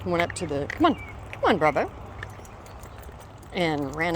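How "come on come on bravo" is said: high, coaxing call to the dog; sing-song high call to coax the dog along. Real, not acted. Calling out to the dog.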